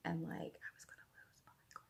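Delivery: fast, hushed whisper. Real, not acted. Almost losing it over a unicorn charm, whispered. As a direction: whisper it like a thrilled secret.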